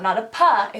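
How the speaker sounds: usual speaking voice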